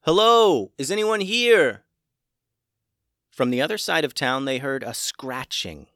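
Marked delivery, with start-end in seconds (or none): 0.0-1.9 loud high shout searching for survivors; calling out. A narrator's reading. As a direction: shout into the empty town. Max calling out in ruins.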